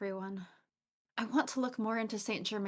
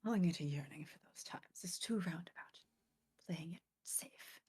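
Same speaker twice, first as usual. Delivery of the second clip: whispering; 9 dB quieter than usual. Acted. Reading a confession, whispered.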